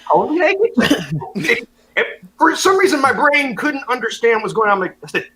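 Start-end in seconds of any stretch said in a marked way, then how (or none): 0.1-1.7 incredulous. Retold or imitated. Stunned repeat of lady's words.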